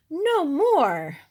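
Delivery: very loud high shriek; queen's scream. Acted. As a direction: scream it to end the show. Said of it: "the queen screamed".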